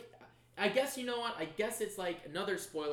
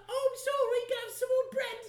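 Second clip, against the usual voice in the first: high, loud pleading urchin; Tiny Tim; 5 dB louder than usual. Retold or imitated. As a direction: beg meekly like a poor orphan boy. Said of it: "sound like Tiny Tim".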